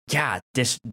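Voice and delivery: ordinary delivery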